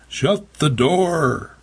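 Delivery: high, drawn-out mock shout. A narrator's reading. Lester flees into the kitchen, high drawn-out mild shout. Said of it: "He shouted".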